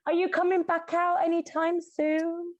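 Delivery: high mock call to a gamer boyfriend. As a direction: call up the stairs, coaxing. Calling boyfriend out of game.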